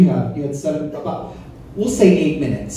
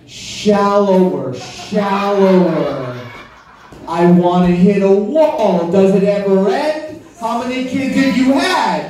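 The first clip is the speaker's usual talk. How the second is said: mock complaining lover; 6 semitones higher than usual. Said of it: "be like".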